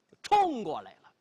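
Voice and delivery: usual speaking voice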